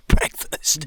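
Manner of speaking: loud call to eat. Real, not acted. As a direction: shout it across camp. Calling out breakfast.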